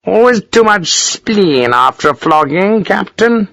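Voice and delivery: high, drawn-out officer's voice; pompous colonel. A narrator's reading. Colonel explaining himself to a captain, pompous.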